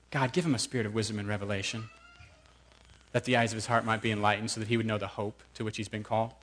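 quiet, fast, hushed prayer; soft imagined prayer. Retold or imitated. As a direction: pray it softly under your breath. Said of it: "You know what I bet he prayed?".

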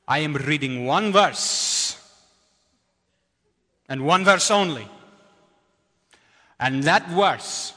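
a hint of a booming Indian preacher, words slow and weighty; Saji's booming voice. Pastor quoting friend Saji to the congregation, in a lightly booming voice. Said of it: "deep booming voice".

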